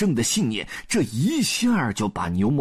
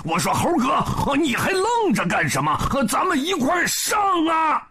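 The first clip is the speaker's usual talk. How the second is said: gleeful high-pitched rallying cry; crow, then rally your partner; delighted shout; 9 semitones higher than usual. A narrator's reading. Zhu Bajie urges Monkey to join in, delighted high shout. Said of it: "高兴大叫一声".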